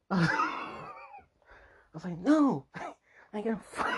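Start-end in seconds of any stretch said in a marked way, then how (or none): 2.2-3.9 high mock protest through laughter; squealed, hammed-up no; laughing refusal. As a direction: crack up while acting out a refusal. Retold refusal while laughing.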